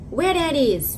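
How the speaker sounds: high, loud imitation of the driver's accent; mimicked Lyft driver. Lyft driver asking passenger about Kenya, mimicked high and loud. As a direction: mimic a baffled driver, pitched well up. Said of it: "I will try to mimic the accent and the mannerisms".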